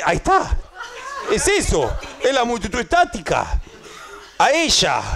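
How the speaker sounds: high, exclaimed mock revelation; high-pitched, hammed-up excitement; acted discovery. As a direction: cry out as if pointing at the culprit. Acts out spotting the static crowd.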